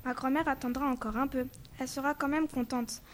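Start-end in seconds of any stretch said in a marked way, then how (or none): none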